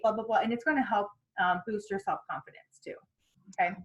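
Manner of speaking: ordinary delivery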